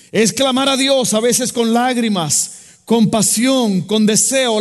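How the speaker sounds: fervent pastor preaching; preaching at full force. Acted. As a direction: drive the message home to the congregation. Preaching on humility and prayer.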